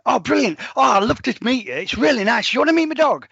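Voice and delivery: high, loud, gushing hello; imitating an overexcited dog owner; overexcited greeting. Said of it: "She's going".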